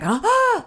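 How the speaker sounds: sharp gasp into a breathy ah; mock gasp of shock; horrified gasp. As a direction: gasp as if seeing something awful. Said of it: "It's like".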